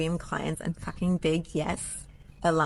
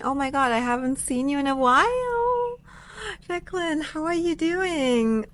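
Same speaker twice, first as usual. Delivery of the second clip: much higher, delighted hello; excited greeting; 9 semitones higher than usual. Real, not acted. Greeting a viewer she hasn't seen in a while, excited.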